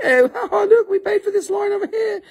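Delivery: high squeaky gloating falsetto; gloating mock voice. Customer crowing to the waiting line, gloating falsetto. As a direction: crow it in a silly falsetto.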